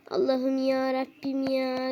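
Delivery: ordinary delivery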